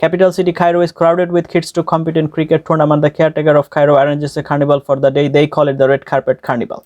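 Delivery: connected tongue-twister run without pauses; all run together. Shown as a demonstration. Teacher shows the whole thing at once, run together. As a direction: roll straight through in one even stream. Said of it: "আবার শুনেন".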